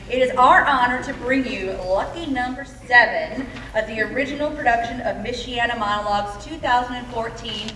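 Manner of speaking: loud, rousing stage introduction; show announcer. Real, not acted. Director opens the show for the audience, loud announcer delivery. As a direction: announce it big to a packed house.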